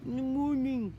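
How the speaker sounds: high, loud mumble through sealed lips; glued-mouth muffle. Acted. Boy with glued mouth trying to greet, muffled and straining. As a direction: say it as if your lips are glued shut. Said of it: "had his mouth glued".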